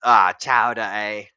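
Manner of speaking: chowder in a put-on Boston accent; mock Boston accent, R dropped. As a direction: play up the Boston vowels. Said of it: "the fucking Boston accent is wonderful".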